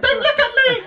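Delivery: put-on high squealing frightened child; scared toddler. Hiding from a stranger, loud high childish squeal. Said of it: "three-year-old boy looking for his mummy's skirt".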